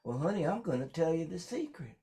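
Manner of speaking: put-on high, sweet old country grandma. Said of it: "She goes".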